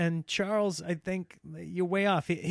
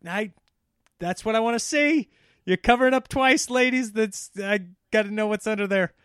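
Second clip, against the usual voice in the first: mock eager leer; 6 semitones higher, 6 dB louder than usual. Mock leering man begging.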